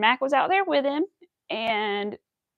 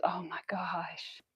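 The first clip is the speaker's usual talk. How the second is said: whisper; 4 semitones lower, 13 dB quieter, much slower than usual. Real, not acted.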